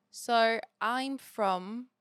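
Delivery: slow, careful British accent attempt. Shown as a demonstration. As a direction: ease into a British accent, word by word. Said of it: "I have to get in it a little bit".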